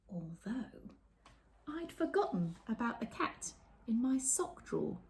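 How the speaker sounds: sulk, then drop to a secret; mild storybook sulk. A narrator's reading. Reading the girl's lonely ending to children, mild sulk then whisper. Reads picture book aloud.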